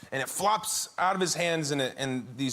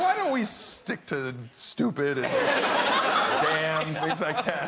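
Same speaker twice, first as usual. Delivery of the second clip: laughing; much slower than usual. Real, not acted. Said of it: "hard not to laugh".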